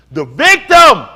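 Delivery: shouted out high and loud in full fervour; rising preacher's holler; impassioned shout. Real, not acted. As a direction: cry it out to the room like a preacher. Preaching the victim's plight.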